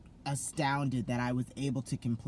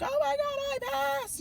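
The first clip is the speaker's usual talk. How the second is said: very high, louder squeal of joy; excited squeal; 4 dB louder than usual. Retold or imitated. Telling her teacher she passed, very high squeal. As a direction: shriek it to the teacher. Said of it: "I was like".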